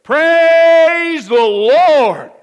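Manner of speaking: long drawn-out loud shout of praise. Retold or imitated. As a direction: call it out from the porch, stretched long and loud. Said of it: "she would shout this".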